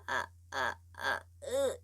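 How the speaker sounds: squeezed, choked "ah" from a tight throat; strangled voice. Shown as a demonstration. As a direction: clamp the throat and push out a pinched "ah". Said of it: "이렇게 쪼여버리면 소리가 안 나요".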